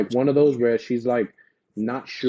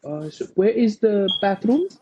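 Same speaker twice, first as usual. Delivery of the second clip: unsure English, every last word pitched up high; 10 semitones higher than usual. Retold or imitated. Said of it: "they'd be like".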